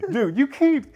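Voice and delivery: high, loud laughing plea; cracking up while scolding; laughing protest. Real, not acted. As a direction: protest through helpless laughter. Laughing at noisy toy interruption.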